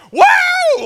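put-on high, loud whoop of a thrilled kid; excited kid whoop. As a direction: shout it like a kid bursting out of a van. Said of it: "jumping out of the van going".

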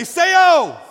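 high, full-force shout; emphatic shout. Shown as a demonstration. Preacher pushes congregation to repeat it like they mean it, emphatic shout. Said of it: "say it like you mean it".

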